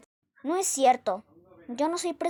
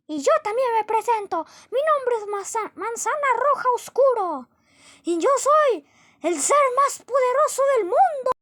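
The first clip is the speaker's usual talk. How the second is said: high, loud unhinged villain shouting; shrill-free but wild high-pitched boasting threats; crazed apple; 9 semitones higher, 5 dB louder than usual. Acted. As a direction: rant like a mad apple who thinks he rules the world. Crazy apple introduces himself.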